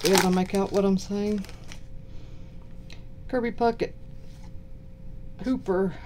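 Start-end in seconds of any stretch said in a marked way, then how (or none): none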